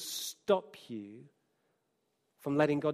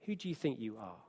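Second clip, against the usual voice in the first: soft, scornful taunt; voice of disapproval; 6 semitones lower, 10 dB quieter, faster than usual. Acted. Preacher voicing disapproval to the listener, quiet and scornful. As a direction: hush it down, sneering at the listener. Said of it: "Disapproval will say to you".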